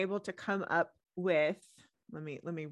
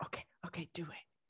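whisper; 2 semitones lower, 9 dB quieter than usual. Acted. Whispering before summoning ghosts.

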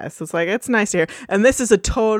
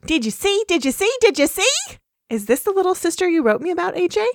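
excited high filly voice; 10 semitones higher, faster than usual. Acted.

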